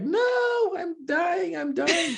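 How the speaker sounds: high mock cry of dying, half laughing; mock panic. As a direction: wail it like a hammed-up horror victim. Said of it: "I was like".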